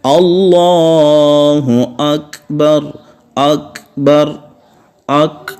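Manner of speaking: slowly chanted Allahu akbar, long vowels; adhan call. Retold or imitated. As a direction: call it out like a muezzin. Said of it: "دیکھیں آخر میں تھوڑی سی آواز".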